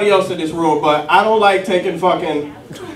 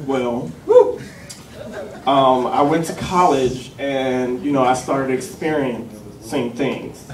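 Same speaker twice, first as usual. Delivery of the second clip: slow, softer, halting admission; hesitant confession; 5 semitones lower, 5 dB quieter, slower than usual. Retold or imitated. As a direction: ease into it with long pauses. Friend's awkward confession acted out.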